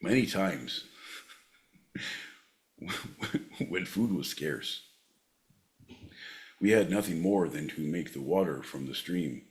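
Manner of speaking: put-on low, soft old Scotsman in a Scottish accent; old Scotsman reminiscing. Plays old Scotsman recalling hard times.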